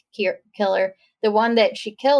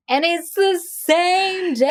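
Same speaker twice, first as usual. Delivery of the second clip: dramatic reveal; 7 semitones higher, slower than usual. Pretend.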